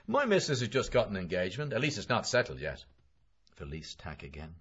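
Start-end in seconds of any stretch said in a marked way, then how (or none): 0.1-2.8 higher, louder boasting acquaintance; a lightly voiced chatty McCoy; McCoy's boast. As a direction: show off about the wife's engagement. McCoy boasting about his wife.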